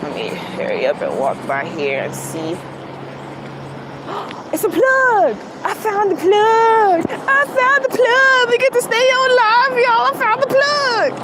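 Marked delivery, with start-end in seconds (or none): 2.6-4.5 sudden sharp intake of breath; sharp gasp. Real, not acted. Vlogger spotting the plug, startled gasp. Finds the plug she searched for. 4.5-11.1 excited. Real, not acted. Vlogger thrilled to find the outlet, shouting high. Finds the plug, stays live.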